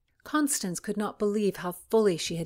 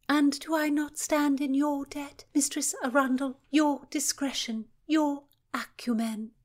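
high, measured noblewoman's voice; lady's voice; 9 semitones higher, 4 dB louder than usual. Acted. Cecilia volleying thanks back to Philomena, high and gracious. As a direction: lift it up and speak like a gracious lady.

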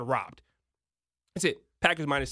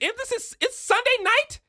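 surprised disbelief; 13 semitones higher, 3 dB louder than usual. Real, not acted. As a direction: voice jumps high on Sunday night. Suddenly realizes game time.

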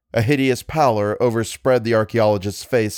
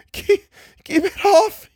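very high and louder, choking; sobbed plea; 4 dB louder than usual. Acted. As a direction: beg in terror, sobbing. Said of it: "he sobbed".

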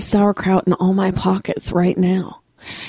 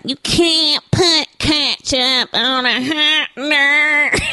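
slow, exaggerated Chicago accent, higher pitched; 5 semitones higher, much slower than usual. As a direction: imitate a Chicagoan with hugely stretched vowels. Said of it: "that's my famous Chicago accent".

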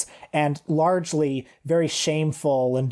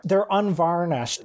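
normal talking voice

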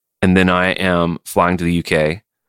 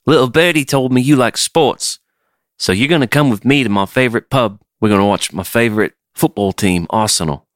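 chummy mock British accent; lightly hammed British voice, starting high; British tour manager; 4 semitones higher than usual. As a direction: imitate a British football fan inviting a mate. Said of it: "new tour manager who's like".

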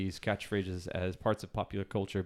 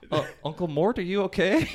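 concerned relative; 8 semitones higher, 5 dB louder than usual. Retold or imitated. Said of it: "People would turn to him and, and say".

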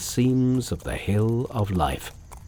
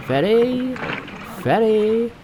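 ferryman's cry; 12 semitones higher, slower than usual. A narrator's reading. Ferryman announces himself along shore, high calling cry. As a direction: cry your trade out across the water. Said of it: "the ferryman again cried".

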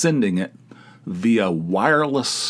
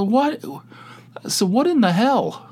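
shocked disbelief; 11 semitones higher than usual. Retold or imitated. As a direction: react in stunned disbelief. Shocked reaction to dick pics.

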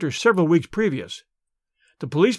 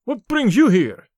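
startled; 4 semitones higher, 4 dB louder, slower than usual. A narrator's reading. Rokoff startled by Tarzan.

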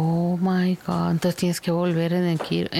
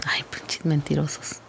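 whispered aside; whispering; 5 semitones lower, 3 dB quieter than usual. Real, not acted. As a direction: hiss it under your breath. Muttering aside at fake bidders.

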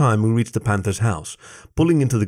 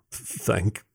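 softer, halting stutter; 7 dB quieter than usual. A narrator's reading. Jason blushes taking back his boxers, stuttering. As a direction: catch on the first sound, embarrassed. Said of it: "Jason stuttered".

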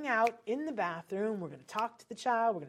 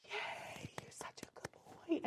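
whispered yay and praise; excited whisper; silent cheer; 9 semitones higher, 10 dB quieter, slower than usual. Shown as a demonstration. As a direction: celebrate without any sound for a noise-shy kid. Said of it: "I used to have to do it silent".

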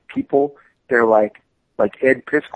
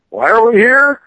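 louder, higher fed-up complaint; exasperated crew; 9 semitones higher, 7 dB louder than usual. Retold or imitated. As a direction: groan it like a bored crew member.

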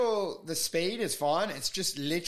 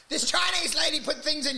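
pitched way up, squeaky; high squeaky voice; 9 semitones higher than usual. Retold or imitated. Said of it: "it's me going like".

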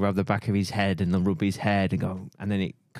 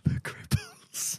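high, slow, breathy whisper; breathy mock whisper; 4 dB quieter, slower than usual. Mock whisper echo of clip.

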